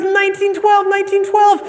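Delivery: very high, thrilled outburst; hammed-up overjoyed cry; excited. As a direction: blurt it twice at a giddy peak. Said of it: "the man gets excited".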